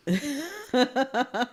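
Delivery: soft little chuckle; real quiet laughter; amused laugh. Real, not acted. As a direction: chuckle softly to yourself. Laughs while summing up week.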